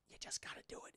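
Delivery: hushed whisper. Retold or imitated. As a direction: whisper it like a secret. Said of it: "Menendez is like".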